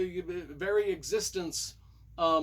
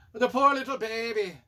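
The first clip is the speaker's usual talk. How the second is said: loud, higher mock-Scottish lament; hammed-up Scottish pity; 6 semitones higher, 7 dB louder than usual. Reviewer pitying the kidnapped baby, in mock Scottish. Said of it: "saying that in Scottish".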